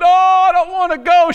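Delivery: loud, high begging wail; mock pleading. Preacher imitating someone begging not to die, mock pleading. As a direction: beg dramatically at full voice. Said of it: "She begging and pawing and pleading".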